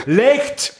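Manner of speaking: sudden high yell of Licht!; shouting. Retold or imitated. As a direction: yell it at a cyclist without a light. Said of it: "Ik roep het, hè". (luid roepen: licht)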